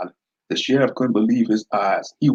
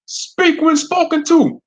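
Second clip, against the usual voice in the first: very high, loud and slow command; yelled; 6 dB louder, slower than usual. Acted. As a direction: bark the order down. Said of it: "Deputy Stockstill yelled".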